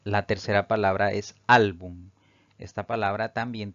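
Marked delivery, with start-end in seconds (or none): 1.5-2.1 ÁL of "álbum" given extra force; "álbum" stressed firmly at the start; penultimate stress. Shown as a demonstration. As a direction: lean on the first syllable of "álbum". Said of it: "la mayor fuerza recae en la penúltima sílaba".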